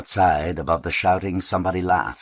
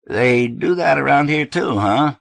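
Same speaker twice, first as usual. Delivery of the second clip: higher, louder forced joking; forced jocular; 10 semitones higher, 7 dB louder than usual. A narrator's reading. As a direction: push a joke to break the tension. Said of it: "Sam forced jocularly".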